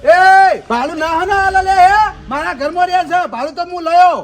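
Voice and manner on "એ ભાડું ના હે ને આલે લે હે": loud sharp call; angry shout. Acted. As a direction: bark it at him. Landlord shouts at tenant.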